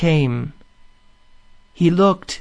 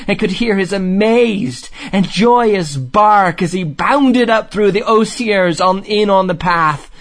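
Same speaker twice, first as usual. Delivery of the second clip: raised, mounting excitement in the narration; animated storytelling; 7 semitones higher, 4 dB louder than usual. A narrator's reading. Narrator telling of Otter's joyful reunion, animated. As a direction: tell it higher, thrilled by the reunion.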